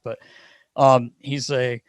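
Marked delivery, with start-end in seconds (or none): none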